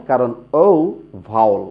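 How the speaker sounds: ordinary talk, nothing marked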